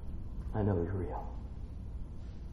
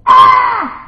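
short, loud, high fright scream; acted terrified yelp; mock scream of fear; 10 dB louder than usual. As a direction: scream as if seeing the dead. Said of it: "That'd be fear".

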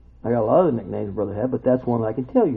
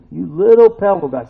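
"You little pebble guy" said louder with a rising tease; "little" stressed in a playful, louder repeat; teasing emphasis; 5 semitones higher, 7 dB louder than usual. Shown as a demonstration. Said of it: "repeated louder".

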